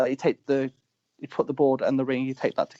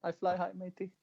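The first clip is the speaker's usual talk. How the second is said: higher, softer teasing taunt; playful ribbing; 6 semitones higher, 10 dB quieter than usual. Retold or imitated. Wrestler goading his rival mid-match, playful ribbing. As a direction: tease lightly, high and soft. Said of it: "I was just ribbing and going".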